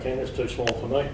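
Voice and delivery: ordinary talk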